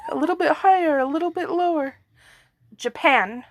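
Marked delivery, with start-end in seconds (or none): 0.1-2.0 higher, laughing mock-official voice; lightly mock formal rating read. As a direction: play the pompous forecaster, giggling. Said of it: "very formal, aren't I".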